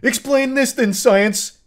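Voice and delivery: high, loud triumphant challenge; imitating him daring science; mock defiant. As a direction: shout it as a proud dare. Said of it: "to prove a point".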